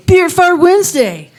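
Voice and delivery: shrieked high-pitched tagline; cartoonish high squeal; squeaky high answer.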